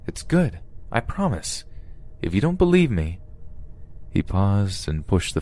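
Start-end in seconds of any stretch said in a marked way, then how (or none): 0.1-3.2 higher, lightly pleading captor; coaxing captor. Acted. Captor promising the food is good, coaxing. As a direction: coax gently with a lifted voice.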